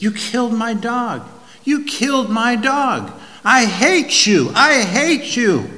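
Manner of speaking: high, loud, raw screams of grief and rage; anguished shrieking. Acted. Boy screaming at the father who shot his dog. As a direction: scream it at the father like a heartbroken child. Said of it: "And he shrieks".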